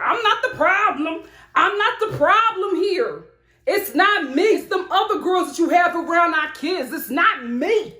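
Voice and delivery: lightly voiced upset woman; a hint of a pleading mother; imitating Sarah. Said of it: "That accent".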